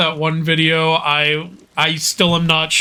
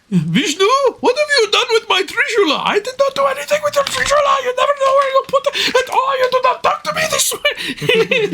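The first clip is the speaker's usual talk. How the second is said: put-on very high, fast, shrill Indian accent quarrel; hammed-up Indian god bickering; mock Indian accent; faster than usual. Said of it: "you should do an Indian accent".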